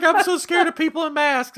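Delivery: loud, high mock-frightened voice; scared girl. Frightened girl on Halloween night, loud high imitation.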